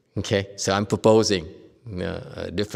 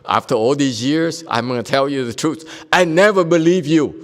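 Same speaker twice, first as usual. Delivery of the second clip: louder, rising to a higher punchline; mock teen confession; 4 semitones higher, 5 dB louder than usual. Teacher voicing a grown teen telling mom the truth, louder. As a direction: tease it out as a cheeky teen.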